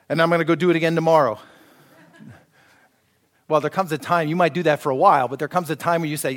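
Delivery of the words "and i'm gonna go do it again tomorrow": fast, louder tossed-off ending; mock insincere promise; rushed cheeky aside. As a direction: rattle it off like you don't mean it. Joke of insincere confession.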